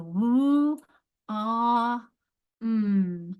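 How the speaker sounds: "woo, ah, mm" as separate drawn-out sounds. Shown as a demonstration. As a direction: segment "woo, ah, mm" with clear pauses between. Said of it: "sound by sound".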